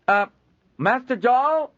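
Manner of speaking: high, loud call across the yard. Acted. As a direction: call out from the wagons. Trader calls from wagon.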